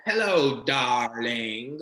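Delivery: drawn-out, loud British greeting; mock British accent. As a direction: imitate a possessed woman's British accent. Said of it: "animated into a British accent".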